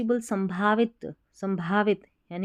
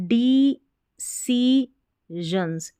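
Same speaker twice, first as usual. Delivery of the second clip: "decisions" drawn out in separate syllables; 3 semitones higher, 3 dB louder, much slower than usual. Shown as a demonstration. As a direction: break "decisions" slowly into de-ci-sions. Teacher sounds out the new word. ("decisions" को धीरे-धीरे तोड़कर)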